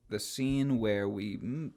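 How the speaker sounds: ordinary delivery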